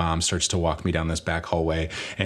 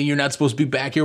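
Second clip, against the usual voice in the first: higher-pitched, rushed mock telling-off; retold yelling; 6 semitones higher, faster than usual. Workers yelling at a kid backstage, retold yelling. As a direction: scold fast in an imitated yell. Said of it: "yelling at me".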